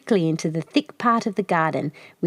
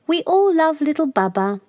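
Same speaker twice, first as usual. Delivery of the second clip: light high bird voice, higher; bird chorus; 8 semitones higher, 3 dB louder than usual. A narrator's reading. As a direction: birds answering from across the garden. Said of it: "several of the others would reply".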